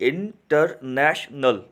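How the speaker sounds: इंटरनेशनल split slowly into four syllables, in-ter-na-tion-al; teacher stretches इंटरनेशनल syllable by syllable; slow syllable demo. Shown as a demonstration. To students, teacher sounding out syllables slowly. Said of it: "गौर से यहाँ पे देखिएगा और सुनिएगा".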